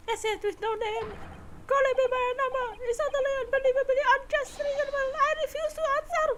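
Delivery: high, rapid indignant protest; indignant prisoner character. Acted. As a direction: voice an affronted prisoner, high and fast.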